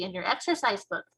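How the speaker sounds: ordinary delivery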